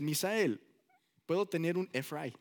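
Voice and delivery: mock Mexican-accented Spanish; grandpa's Mexican accent. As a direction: ask pleadingly in a Mexican accent. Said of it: "he had an accent".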